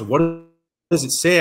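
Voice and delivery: shocked question. Retold or imitated. Father-in-law reacting to the contract, loud surprised. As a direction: blurt it out in alarm.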